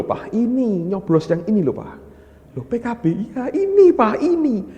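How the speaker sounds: put-on high, insistent little child pointing things out; eager child. Son urging his father, pitched high and eager. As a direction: play the small son tugging at dad.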